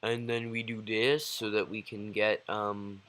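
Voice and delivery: low, subdued tutorial mumble; sleepy, understated walk-through with ums; imitating own older videos. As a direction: imitate a dull, soft-spoken YouTuber. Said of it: "in my other videos, I'm like".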